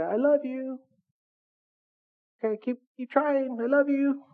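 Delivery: high, sing-song mock voice from far away; mock distant God. Preacher mocks a distant God, high sing-song mock voice. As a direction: parody a careless God tossing off love.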